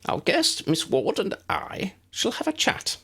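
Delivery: high, bright little wizard voice, kept up; a small, eager professor speaking; tiny professor voice. A narrator's reading. Flitwick voiced in story.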